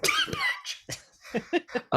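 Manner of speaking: slow, hushed mock curse; whispered swear-word delivery; like a bad word. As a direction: spit the name out softly like profanity. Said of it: "if she needs to say a bad word".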